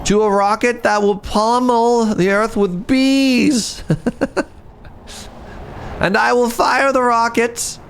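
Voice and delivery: high, theatrical evil villain; hammed-up villain demand with a cackle; supervillain voice. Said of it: "how's my supervillain accent?".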